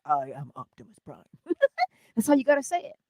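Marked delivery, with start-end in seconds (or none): 0.0-1.2 a hint of Optimus booming his name; Optimus Prime voice. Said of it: "You know how Optimus says".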